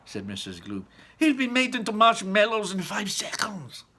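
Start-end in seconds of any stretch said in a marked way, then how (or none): 1.2-3.8 high, loud whispered panic; high loud breathy dread; frightened mother. Acted. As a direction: blurt the fear in a rush. Mother fearing for son.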